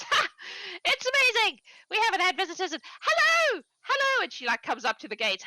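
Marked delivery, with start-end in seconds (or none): 0.0-4.2 much higher and louder, gushing; overexcited old woman. Acted. Lonely farm wife greets the visitors, high and gushing. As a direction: squeal with delight at visitors. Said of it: "And she goes".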